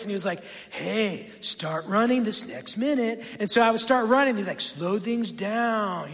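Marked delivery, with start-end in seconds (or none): 0.7-3.2 softer, laid-back running coach voice; cool-guy voice. Retold or imitated. Calming app coach to a runner, cool voice. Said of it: "he had, like, this really cool voice". 4.6-6.1 slow, drawn-out, lower mellow coach; calming app voice. Retold or imitated. App coach easing the runner's pace, slow calming voice. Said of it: "he just was really calming".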